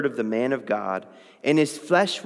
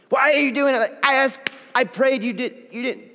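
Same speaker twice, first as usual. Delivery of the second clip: high, exasperated complaint at God; hammed-up annoyed prayer, words tumbling; mock frustrated rant; 9 semitones higher, faster than usual. Believer complaining to God, mock exasperated and high. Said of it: "people ... stir up anger".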